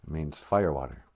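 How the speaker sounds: normal talking voice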